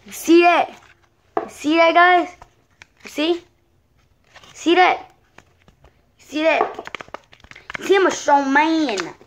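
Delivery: thrilled show-off calls; excited bragging. Real, not acted. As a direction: shout it proudly to the camera. Showing off broken bat.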